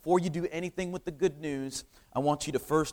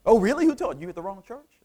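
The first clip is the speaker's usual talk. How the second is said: mock astonished double-take; imitating a surprised reaction, starting high; 3 semitones higher, 4 dB louder, faster than usual. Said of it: "made it sound like I was surprised".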